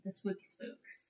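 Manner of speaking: breathy spooky whisper. Acted. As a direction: hush it like a ghost story.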